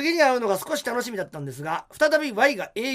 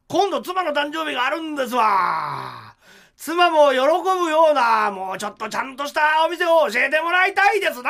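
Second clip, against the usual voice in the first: pompous demand; 6 semitones higher, 7 dB louder than usual. Retold or imitated. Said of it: "と言うと".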